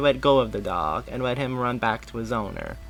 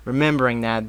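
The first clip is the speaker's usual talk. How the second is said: usual speaking voice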